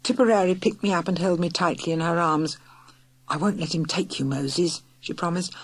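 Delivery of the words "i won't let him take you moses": whispered promise. Acted. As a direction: breathe it reassuringly to a baby. Tipperary comforting Moses, held tight.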